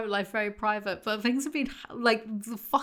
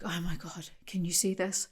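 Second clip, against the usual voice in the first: hushed, softer, overdone awe; mock dramatic gushing; 3 semitones lower, 8 dB quieter than usual. Mocking a girl trying foundation serum, softer mock awe. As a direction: breathe it like a miracle. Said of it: "she was being so dramatic".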